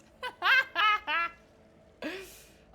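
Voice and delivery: loud, high laughter. Real, not acted. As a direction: burst out laughing. Laughs at captive's curses.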